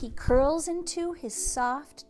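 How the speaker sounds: sing-song picture book reading; reading to children. A narrator's reading. Librarian reading a bedtime story to kids.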